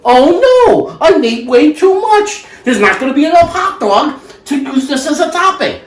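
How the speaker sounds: hammed-up "oh no" worry; mock dismay. Mock panic over too much food.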